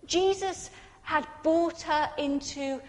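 normal talking voice

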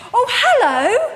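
bright, high, loud hello of surprise; startled cheery hello; surprised greeting. Retold or imitated. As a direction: greet him as if delighted to be recognised. Stranger greets her in shop.

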